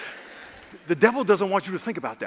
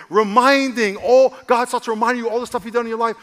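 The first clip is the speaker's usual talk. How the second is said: usual speaking voice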